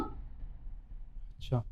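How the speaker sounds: barely voiced, drawn-out; breathy stunned. Husband stunned by his wife's confession, breathy. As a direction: let the breath carry the shock.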